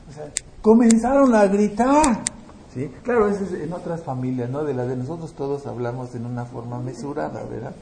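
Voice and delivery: ordinary talk, nothing marked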